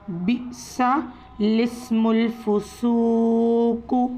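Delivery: बिसा लिस्मुल फुसूकु with both alifs skipped; very slow Quranic recitation. Shown as a demonstration. Teacher recites the word for students. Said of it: "ऐसे ही हम पढ़ेंगे".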